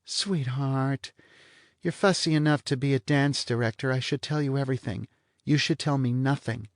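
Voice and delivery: a bored blonde toying with him; lazy blonde. Acted. Blonde teases Vanier about his sex appeal, languid and high.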